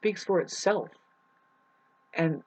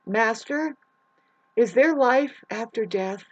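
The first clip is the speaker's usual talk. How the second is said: earnest seeker; 6 semitones higher, 5 dB louder, slower than usual. Acted. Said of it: "somebody asked the beloved master".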